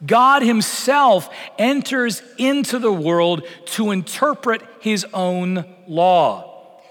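ordinary delivery

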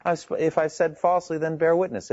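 usual speaking voice

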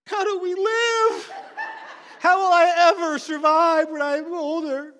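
high, loud, slow wail of a laughing mock-panicked child; panicking kid. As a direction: ham up the kid's despair, laughing through it. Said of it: "And she's like".

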